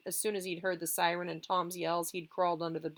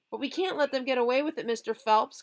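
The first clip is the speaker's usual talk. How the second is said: fast, high call from another room; 6 semitones higher, 6 dB louder than usual. Acted. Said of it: "called Joe from the living room".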